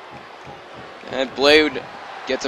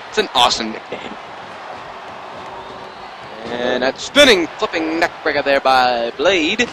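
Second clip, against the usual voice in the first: announcer voice. Pretend. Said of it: "I don't know why I'm talking like this".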